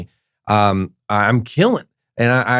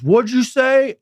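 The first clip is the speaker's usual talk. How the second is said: high-pitched retold heckler challenge; mock outraged question, pitched way up; offended challenge; 13 semitones higher, slower than usual. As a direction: imitate an affronted man demanding an answer. Retold offended man challenging comic.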